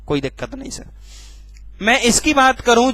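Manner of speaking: normal talking voice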